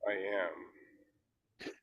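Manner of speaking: very soft, gentle statement; hushed and gentle. Shown as a demonstration. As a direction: let it out very quietly. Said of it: "Once more".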